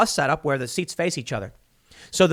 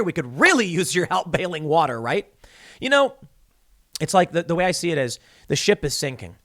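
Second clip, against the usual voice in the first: usual speaking voice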